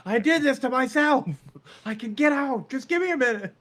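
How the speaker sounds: loud, high, desperate protest; shouting patient. Acted. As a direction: yell it like a stubborn patient. Acted hospital scene, patient yelling.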